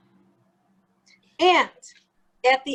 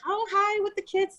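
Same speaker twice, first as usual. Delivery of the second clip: mock high, sugary hello to the kids; sweet greeting; 10 semitones higher, 4 dB quieter than usual. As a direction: play the charming grandpa act, pitched well up. Mob father-in-law greeting the kids.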